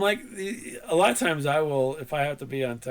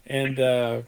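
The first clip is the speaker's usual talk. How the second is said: ordinary talk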